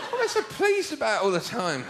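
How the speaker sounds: ordinary talk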